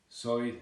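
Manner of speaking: "So" standing for side, OI vowel; Southern Irish pronunciation. Shown as a demonstration. Teacher demonstrating Southern Irish, "So" with OI vowel. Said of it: "again, showing the O I".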